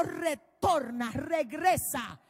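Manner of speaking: slow, loud order to "Retorna, regresa"; shouted command. Retold or imitated. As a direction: drag out each word as a booming command. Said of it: "le dio orden y le dijo". (orden gritada y lenta)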